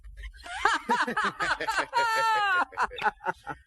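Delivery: high happy laughter; high delighted laugh. Acted. Crusoe laughing at Friday's English, high delighted laugh. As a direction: burst into a bright laugh.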